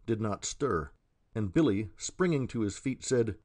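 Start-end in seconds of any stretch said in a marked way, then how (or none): none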